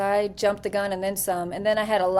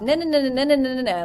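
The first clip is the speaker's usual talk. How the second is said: a fast, raised stream of no's; rapid-fire no; 5 semitones higher, 5 dB louder, faster than usual. Retold or imitated. Sergeant cutting off the lead on the phone, rapid-fire. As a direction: rattle them off like ninety miles an hour. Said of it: "like ninety miles an hour".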